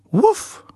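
high yappy bark; dog bark. A narrator's reading. Narrator barks for Rocky the dog, high and playful. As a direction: yip it out like a small excited dog. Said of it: "barked Rocky".

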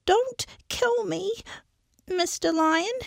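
high squeaky pleading voice; trembling little mouse to the lion; frightened mouse. Acted. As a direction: beg for your life as a tiny mouse. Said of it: "mouse was very frightened".

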